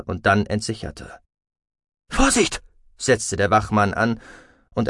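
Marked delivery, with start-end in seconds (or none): 2.1-2.6 high, sudden warning; alarmed warning. Acted. Guard facing a released weapon, alarmed warning.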